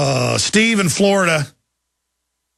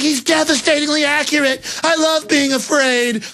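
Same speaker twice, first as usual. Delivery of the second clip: much higher, slowing fake adoring fright; mock swooning fear; 10 semitones higher than usual. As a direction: gush like a scared fan in a mocking voice. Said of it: "everybody's like".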